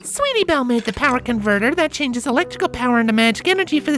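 put-on very high child pony voice; excited filly. A narrator's reading. A filly explaining the power converter, high and eager.

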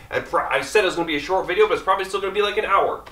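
rapid-fire rushed delivery; talking fast. Real, not acted. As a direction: rattle it off quickly. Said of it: "I'm talking as fast as I can".

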